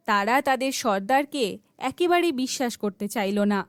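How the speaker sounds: ordinary delivery, nothing marked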